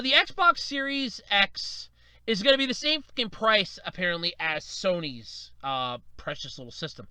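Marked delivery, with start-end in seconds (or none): none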